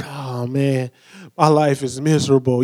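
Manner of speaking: low, soft, mopey imitation; mock-gloomy sigh of a voice; gloomy Eeyore. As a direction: mimic a mopey friend moaning, a touch hammed-up. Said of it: "we sound like Eeyore".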